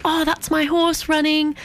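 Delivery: put-on high, slow, proud little-girl voice; imitating little girl. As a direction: mimic the girl proudly pointing out her horse. Little girl watching her horse.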